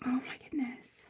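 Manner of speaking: whispered, choked-up oh my gosh; a real awed whisper, no voice; breathless whisper. Real, not acted. Overcome by a friend's gift, a real whisper.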